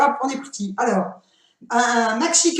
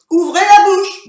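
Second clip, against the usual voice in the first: louder, insistent order; loud command; 6 semitones higher, 8 dB louder than usual. Real, not acted. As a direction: call it out firmly. Repeating command louder to kids.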